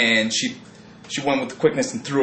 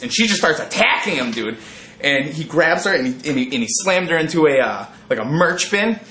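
ordinary talk, nothing marked

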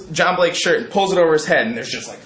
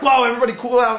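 calling out; 10 semitones higher, much faster than usual. Retold or imitated. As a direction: shout everyone down to stop it. Crowd calming the fight.

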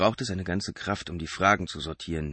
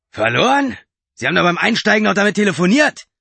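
much higher, loud accusing voice; indignant driver; 14 semitones higher, 11 dB louder than usual. A narrator's reading. Driver calling out Mark's lie, loud and indignant. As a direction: throw the lie back at him.

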